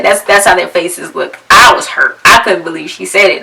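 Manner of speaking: ordinary delivery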